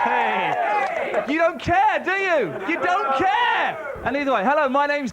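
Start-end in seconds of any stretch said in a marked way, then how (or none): 1.3-2.5 mock outrage. Host teasing the audience. 2.7-3.9 slow, stretched mock indignation, higher; drawn-out mock outrage. Host mocking the silent crowd with a slow hurt whine.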